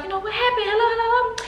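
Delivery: very high, panicky mock voice; imitated frightened mother. Scared mother on the phone, imitated panic. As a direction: act out a scared parent calling down the line. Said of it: "she's scared and she crying".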